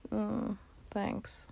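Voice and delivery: ordinary talk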